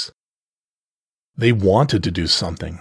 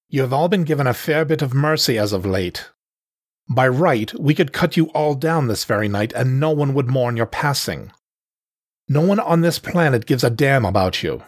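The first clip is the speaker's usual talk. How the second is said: higher-pitched, contemptuous leader's address; menacing panther leader; 5 semitones higher than usual. Acted. Panther leader lords it over the exiles, scornful menace. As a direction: threaten them like a scornful clan chief.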